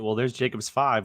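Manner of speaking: ordinary delivery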